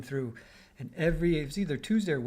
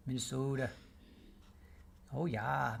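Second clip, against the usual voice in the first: long pure O in Minnesota, Minne-SOH-ta; Minnesota accent; 7 dB quieter than usual. Shown as a demonstration. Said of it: "I can only say that because I'm from the Midwest".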